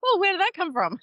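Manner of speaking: high, surprised inner voice. Retold or imitated. Woman surprised at her own firm no, high and amazed. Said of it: "coming out of my mouth going".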